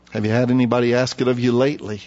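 ordinary talk, nothing marked

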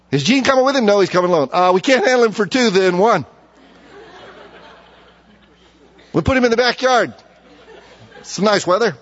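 joking sibling voice. Retold or imitated. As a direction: play the reluctant sister for laughs. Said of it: "they trade me off".

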